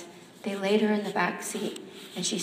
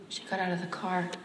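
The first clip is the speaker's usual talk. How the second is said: ordinary delivery, nothing marked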